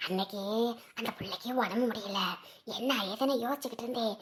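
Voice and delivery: very soft, low hushed telling; confiding gossip. Acted. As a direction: keep it secret, barely voiced. Confiding gossip after calling her closer.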